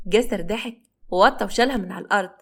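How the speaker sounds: ordinary delivery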